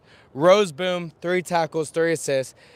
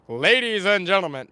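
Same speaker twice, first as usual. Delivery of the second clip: mild announcer; 2 semitones higher than usual. Pretend. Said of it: "could I announce the game right now? Absolutely".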